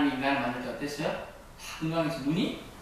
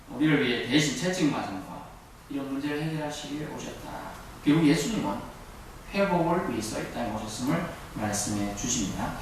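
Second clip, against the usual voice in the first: normal talking voice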